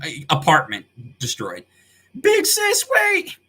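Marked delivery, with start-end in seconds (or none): 2.2-3.4 high, loud call after a sister running off. Acted. Younger sister calls after her big sister, high and loud. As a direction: yell after her, much higher than usual.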